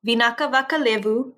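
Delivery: ordinary delivery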